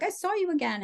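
put-on high, German-accented grandma quote; thick German accent, high and teasing; imitating grandma's German accent. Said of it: "a very thick German accent".

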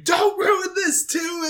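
high, slow mock scream; Sarah Palmer screaming. Podcaster acts out mother at the funeral, screaming. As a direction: stretch it into an imitated high wail. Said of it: "Sarah Palmer is screaming".

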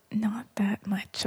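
slow sheepish whisper. Real, not acted. Playful admission.

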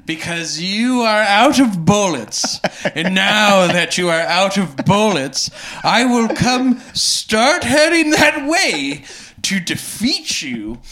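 slow, grandiose mock Count; hammed-up villain monologue. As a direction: pompously announce the attack, stretching key words.

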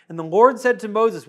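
usual speaking voice

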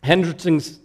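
normal talking voice

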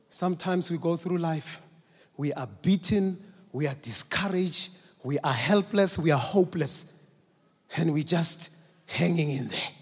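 ordinary talk, nothing marked

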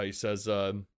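ordinary talk, nothing marked

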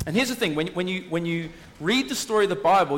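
normal talking voice

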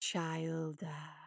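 low, slow intoned name; low monster intoning; intoning hare. Acted. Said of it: "Luther intoned".

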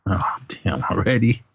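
breathy whisper. Real, not acted. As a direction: whisper it in disbelief. Shocked aside.

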